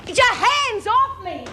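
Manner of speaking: high, shouting protest; angry protest. Acted. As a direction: fight them off, yelling. Prisoner dragged away protesting.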